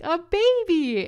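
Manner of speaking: very high, loud, slow joyful yell; shouted announcement. Retold or imitated. To the midwives in the tub room, a huge high yell. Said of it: "I was like".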